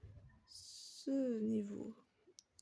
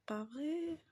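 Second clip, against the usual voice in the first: ordinary talk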